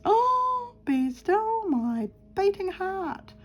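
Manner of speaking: high, slow, hammed-up swoon; theatrical gasp of longing, drawn out slowly; mock swoon. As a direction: sigh it out like a lovestruck heroine. Swooning over a craft box.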